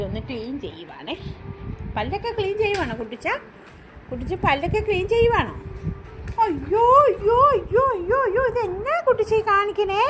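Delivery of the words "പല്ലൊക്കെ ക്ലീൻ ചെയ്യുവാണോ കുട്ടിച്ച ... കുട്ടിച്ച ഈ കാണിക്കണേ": higher, faster excited cooing. Real, not acted. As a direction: gush over the dog chewing. Baby talk to dog chewing.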